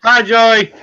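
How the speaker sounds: cheery call-out to a viewer; loud greeting. Real, not acted. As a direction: shout a hello to the chat. Greeting friend on the chat.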